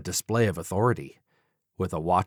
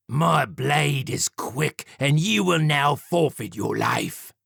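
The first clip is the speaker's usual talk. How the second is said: slow, smug villain warning; menacing Jack; 6 semitones higher, slower than usual. Acted. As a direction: warn him coolly and slowly. Villain Jack voiced in story.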